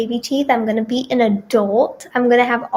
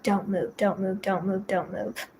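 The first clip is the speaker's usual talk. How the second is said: soft, murmured don't move to herself; hushed, steadying herself through fear; quiet self-talk; 3 semitones lower, 10 dB quieter than usual. Retold or imitated. Patient calming herself while doctor hunts a vein, hushed murmur. Telling herself to stay still.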